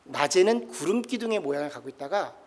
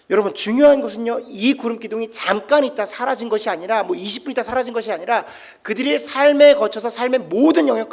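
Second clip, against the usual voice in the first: rising, loud sermon delivery; full-voiced pastor driving the point home; fervent preaching; 4 semitones higher, 7 dB louder than usual. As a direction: preach it with building conviction. Preacher building intensity.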